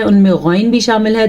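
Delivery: ordinary delivery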